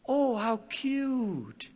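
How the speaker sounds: high, slow, cooing sweetly; doting coo. Retold or imitated. Parent cooing to a small child, high and slow. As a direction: coo at a baby, drawn out. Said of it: "it's the tone of the voice".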